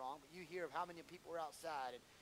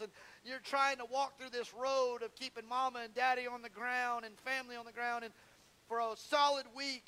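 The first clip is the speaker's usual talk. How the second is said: higher, louder preaching that keeps building; pastor's climb into full-throated preaching; full-force preaching; 5 semitones higher, 7 dB louder than usual. Acted. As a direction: preach it out to the whole church, rising and loud.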